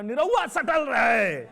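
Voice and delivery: loud, high, drawn-out holler; imitated crowd shouting. Festival crowd yelling the singer's nickname at him, imitated shouting. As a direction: call out slowly at full voice like an excited crowd. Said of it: "और सब लोग चिल्ला रहे".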